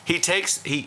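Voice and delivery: ordinary talk, nothing marked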